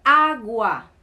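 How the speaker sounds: agua loud and drawn out, stressed on the first a; model pronunciation. Shown as a demonstration. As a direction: give agua as a clear example word, first syllable strong. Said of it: "Tenemos la palabra".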